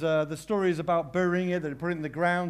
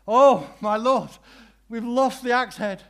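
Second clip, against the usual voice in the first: loud, high anguished cry, slowed; despairing cry; 7 semitones higher, 11 dB louder than usual. Retold or imitated. Woodcutter crying out over the lost axe head, despairing and loud. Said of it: "he cries out".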